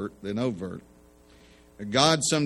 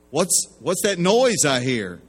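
upset question; 5 semitones higher, 6 dB louder than usual. Retold or imitated. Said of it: "Now he's really upset".

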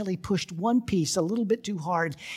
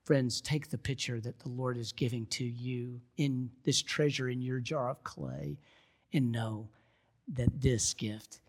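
soft, low, measured preaching; quiet, earnest closing exhortation; hushed sermon appeal; 3 semitones lower, 7 dB quieter than usual. Real, not acted. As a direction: bring the congregation gently to the point, with long pauses.

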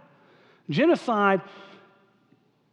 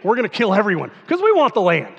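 mock conqueror; 3 semitones higher, 6 dB louder than usual. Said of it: "perversion of manifest destiny".

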